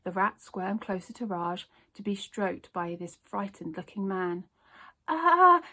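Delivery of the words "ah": high, loud panicked shout. A narrator's reading. Newsagent yells at Zoe as the rat lunges, panicked. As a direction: yell in fright as you flee. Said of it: "shouted Raj".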